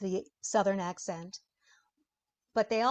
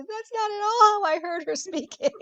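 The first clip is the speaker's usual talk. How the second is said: mock indignant author; 12 semitones higher, 7 dB louder than usual. Imagined author objecting.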